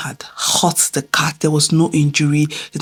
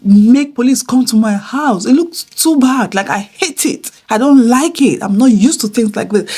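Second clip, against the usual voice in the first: higher-pitched retold scolding; worked-up, higher complaint to a child; angry scolding; 7 semitones higher, 4 dB louder than usual. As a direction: retell an exasperated mother's telling-off. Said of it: "I was so angry at my son".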